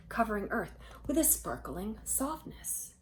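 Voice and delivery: ordinary delivery, nothing marked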